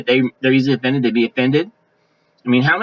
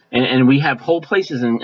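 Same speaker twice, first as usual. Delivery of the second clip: ordinary talk, nothing marked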